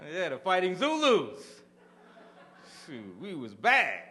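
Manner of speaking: loud and slow, chest out; proud boast. Real, not acted. Comic bragging about his college team, loud and slow.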